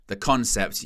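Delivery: normal talking voice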